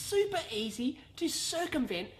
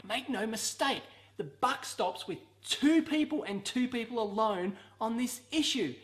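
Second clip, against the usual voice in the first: ordinary talk, nothing marked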